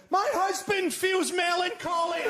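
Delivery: high, slow shouted complaint; hammed-up theatre heckle; mock heckle shout. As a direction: bellow it from the stalls at Hamlet.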